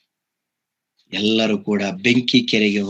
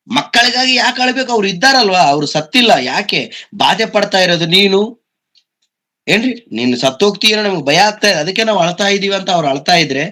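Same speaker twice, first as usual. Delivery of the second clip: fervent preaching; 7 semitones higher than usual. Acted. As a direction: press the congregation with rising fervour. Preacher builds to full force. (ಉತ್ಸಾಹದ ಜೋರು ಪ್ರವಚನ)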